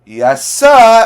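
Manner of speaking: loud, high soldier's answer; snappy reply. Retold or imitated. Reviewer acting out Gus answering the captain, loud and high. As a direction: fire back like a cheeky soldier. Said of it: "He's like".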